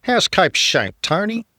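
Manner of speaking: a hint of the old cricket commentator; Richie Benaud impression. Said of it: "I do Richie".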